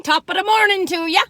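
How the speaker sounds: tossing off an Irish greeting for fun; mock Irish accent. St Patrick's greeting in a light fake Irish accent. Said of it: "my little trying to be Irish accent".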